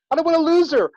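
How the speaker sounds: urgent plea. Retold or imitated. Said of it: "There was urgency".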